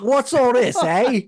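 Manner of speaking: loud, high, put-on Cockney; terrible Cockney accent. Shown as a demonstration. Teased about his accent on a trip, terrible Cockney. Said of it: "use your terrible Cockney accent".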